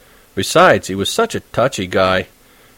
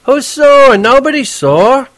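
higher, louder, then slow; testy denial; 6 dB louder, slower than usual. Acted. Horwitz insisting he isn't sore. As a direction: deny it while plainly sore. Cabbie denying he's sore.

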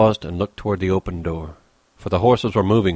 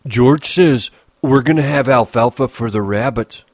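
put-on higher, louder childlike announcement; eager Lennie; 5 semitones higher, 5 dB louder than usual. Lennie tells Crooks about the rabbits, eager. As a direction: announce it proudly, pitched up.

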